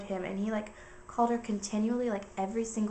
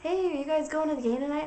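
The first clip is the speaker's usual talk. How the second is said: put-on drunk guy; 7 semitones higher, 4 dB louder than usual. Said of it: "they were drunk".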